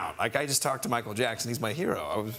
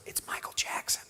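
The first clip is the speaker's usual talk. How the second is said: hushed whisper; 7 dB quieter than usual. Retold or imitated. Director whispering news of the call.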